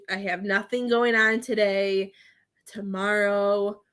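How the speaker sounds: ordinary talk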